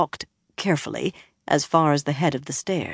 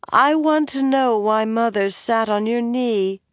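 put-on higher, demanding tipsy girl; drunk young woman; 7 semitones higher, 4 dB louder than usual. A narrator's reading. Elaine pressing the bard about her mother, tipsy and demanding. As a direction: push the question, tipsy and stubborn.

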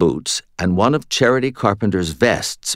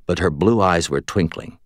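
ordinary delivery

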